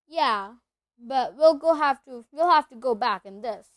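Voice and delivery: big booming half-giant; rough country giant voice; gruff giant voice. Acted. Hagrid voice.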